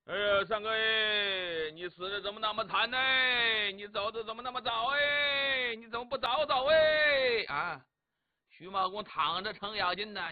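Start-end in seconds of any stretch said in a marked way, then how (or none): 0.0-7.8 fake mourning wail. Cheng keening over supposedly dead third brother, stagey building wail. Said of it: "程咬金哭着".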